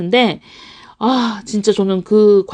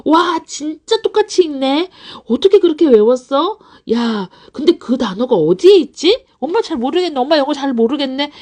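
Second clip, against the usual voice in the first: put-on high, wowed mom praising a child; 감탄하는 엄마 연기; 6 semitones higher than usual. Shown as a demonstration. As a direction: gush in amazement, then play clueless. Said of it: "이렇게 액션을 해주셔야지". (감탄하며 모르는 척하는 엄마 말투)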